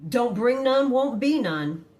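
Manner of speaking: high, loud sing-out of a challenge; loud mock warning. Acted warning against starting a fight.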